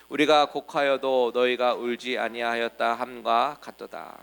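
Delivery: slow, loud unison Bible reading; reading scripture aloud together. Shown as a demonstration. Pastor leads the congregation reading the verse aloud, slow and loud. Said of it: "같이 한번 읽어보겠습니다".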